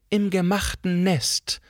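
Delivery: gather dignity before striking; quiet but resonant. A narrator's reading. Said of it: "begann sie leise, aber mit klangvoller Stimme". (leise, aber klangvoll)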